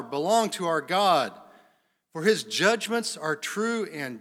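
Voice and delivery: loud, high proclamation of praise; crying out. Acted. Multitude in heaven praising God, loud crying out. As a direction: cry it out like a great multitude. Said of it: "loud voice of a great multitude in heaven, crying out".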